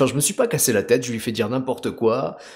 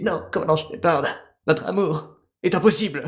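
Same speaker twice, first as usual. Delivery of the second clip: higher mock-dramatic scientist; hammed-up declaration to the commander; Mordine; 6 semitones higher than usual. Scientist rejecting Commander Shepard's love, hammed-up high voice. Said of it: "il est parti dans un super speech".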